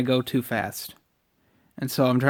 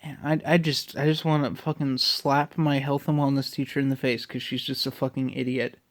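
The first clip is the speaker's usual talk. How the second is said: usual speaking voice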